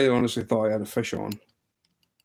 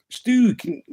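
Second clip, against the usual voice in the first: put-on high Southern-accented call; imitated Southern US accent, pitched up; Southern boy accent; 11 semitones higher, 4 dB louder than usual. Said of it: "pure Southern boy accent".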